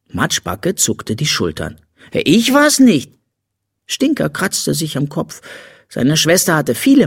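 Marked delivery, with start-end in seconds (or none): 2.1-3.1 put-on high, drawn-out little-girl protest; defensive denial. A narrator's reading. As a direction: protest innocence, high and loud. Girl denies the accusation. (hoher, gedehnter Kinderprotest)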